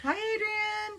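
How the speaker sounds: squeaky high greeting; high silly voice. Pretend. Teasing chat greeting.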